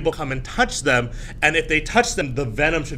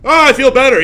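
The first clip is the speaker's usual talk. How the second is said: high, loud put-on relief; hammed-up cry of recovery; mock sudden relief; 8 semitones higher, 10 dB louder, faster than usual. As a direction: exclaim as if instantly cured. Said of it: "be like".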